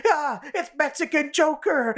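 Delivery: high, drawn-out panicked cry; mock scream of alarm. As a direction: imitate a terrified shout, hammed up. Retold cry on seeing the Joker.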